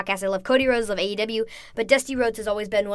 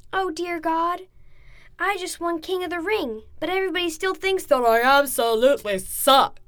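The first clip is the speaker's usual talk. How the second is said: higher, mocking impression of The Rock; 6 semitones higher than usual. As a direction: play The Rock's mocking showman voice. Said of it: "And he did the Rock voice".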